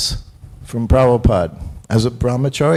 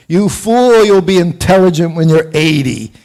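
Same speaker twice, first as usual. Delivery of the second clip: mock teasing; 6 dB louder than usual.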